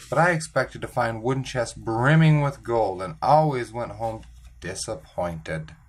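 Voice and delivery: ordinary delivery, nothing marked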